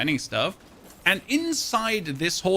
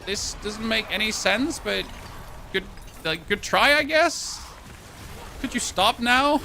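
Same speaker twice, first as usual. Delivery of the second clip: higher, weary put-down to the media; sarcastic plea to stop; exasperated retort; 5 semitones higher, slower than usual. Retold or imitated. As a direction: talk down to them, tired of it. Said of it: "It's like".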